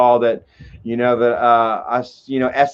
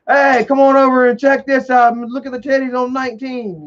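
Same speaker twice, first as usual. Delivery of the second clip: high, leering neighbor calling over; a sleazy neighbor egging him on, pitched up; Office Space neighbor; 9 semitones higher than usual. Retold or imitated. To the narrator, the Office Space neighbor calls him over, leering and high. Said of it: "the neighbor".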